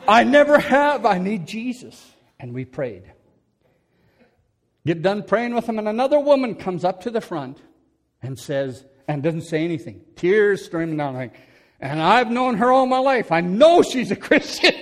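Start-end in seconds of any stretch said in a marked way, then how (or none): 0.1-2.1 loud, high hammed-up wail; wailed plea at full volume; mock weeping. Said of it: "now he's weeping". 11.8-14.7 loud, high incredulous protest; hammed-up bafflement, breaking toward a laugh; exasperated disbelief. Acting out his baffled thought.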